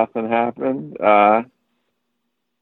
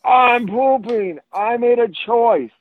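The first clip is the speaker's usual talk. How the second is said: high, drawn-out imitation of a kid; defiant young child; 12 semitones higher, slower than usual. Four-year-old owning up to his dad, imitated defiant child. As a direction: put on a slow, high kid voice.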